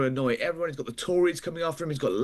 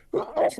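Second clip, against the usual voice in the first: sudden sharp sneeze; sneezing; 4 dB louder than usual. Real, not acted. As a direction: let a real sneeze burst out. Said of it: "sorry, I'm gonna sneeze".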